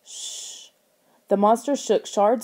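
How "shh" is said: unvoiced shushing hiss; a soft, drawn-out shh; hushing. Hushing sound in the story.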